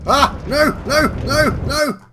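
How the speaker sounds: high, panicked screams of no; panicked screaming. Real, not acted. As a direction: scream in sudden alarm. Train noise panic, screams.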